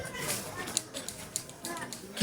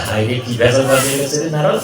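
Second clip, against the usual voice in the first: Shiva lamenting; 6 semitones lower than usual. Acted. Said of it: "ভোলা বাবা বলে".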